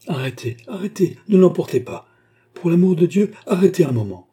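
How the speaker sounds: high, loud pleading from a newcomer; urgent cry. A narrator's reading. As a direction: cry out to stop them. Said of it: "cria le nouveau venu". (cri pressant, voix haute)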